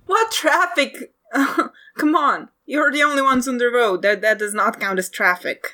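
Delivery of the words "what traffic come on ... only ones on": incredulous. Acted. Incredulous hunter.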